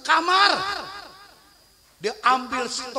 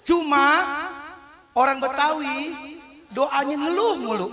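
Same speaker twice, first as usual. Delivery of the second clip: usual speaking voice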